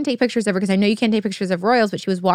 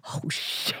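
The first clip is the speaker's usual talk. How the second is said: whispered oh shit through a laugh; breathy laughing whisper; 12 dB quieter than usual. Retold or imitated. Retelling her shock to the co-host, whispered with a laugh. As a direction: hush it out, laughing. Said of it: "I was like".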